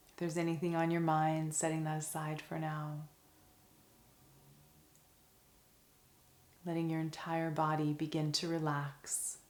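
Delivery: soft, calm meditation voice with long pauses; guided meditation. Acted. Teacher guiding listeners through a five senses meditation, soft and calm.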